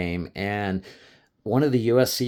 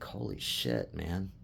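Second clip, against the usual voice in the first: awed hush; 4 semitones lower, 9 dB quieter than usual. Retold or imitated. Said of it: "you're just like".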